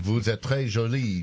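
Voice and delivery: put-on French accent. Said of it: "Did I do that right?".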